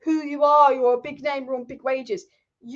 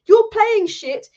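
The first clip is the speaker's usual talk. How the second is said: louder, higher, slow blunt shout; blunt shouted verdict; 7 semitones higher, 7 dB louder, much slower than usual. Retold or imitated. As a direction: bark the verdict slowly, like a pundit fed up. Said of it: "Gary Neville saying".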